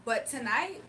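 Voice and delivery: normal talking voice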